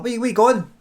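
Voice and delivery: high, loud acted shout after a friend; calling out. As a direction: call after your pal as he walks off. Pal calls after man walking away.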